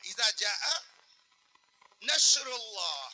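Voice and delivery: نصر said nashr, slow and drawn out; shin pronunciation. Shown as a demonstration. Preacher models the بڑی شین reading for the audience, drawn out. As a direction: stretch نصر slowly as نشر. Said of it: "بڑی شین سے اگر کہا آپ نے".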